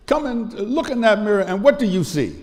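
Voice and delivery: high, loud imitation of a stern mother; harsh mother. Mother sending son to the mirror, imitated harsh response. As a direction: demand it of the child sternly. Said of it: "kind of harsh response".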